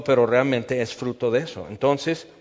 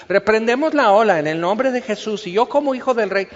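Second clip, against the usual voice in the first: mock rebuke; 7 semitones higher, 4 dB louder than usual. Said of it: "mock believer in the storm".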